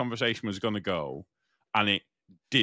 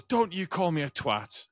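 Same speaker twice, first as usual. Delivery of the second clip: retold shouting; 8 semitones higher, slower than usual. Man shouting back after being insulted, retold mock yell. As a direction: imitate him yelling in outrage, slowly. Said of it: "and he shouts".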